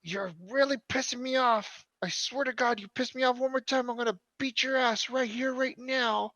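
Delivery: put-on high-pitched angry mother threatening her kids; angry mom. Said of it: "you just hear the mom going".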